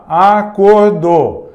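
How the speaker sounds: Acordo slow, the co stressed; sounded-out acordo. Shown as a demonstration. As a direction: lean on the co in Acordo. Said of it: "esse co é a mesma coisa do cometa". (Acordo devagar, marcando o co)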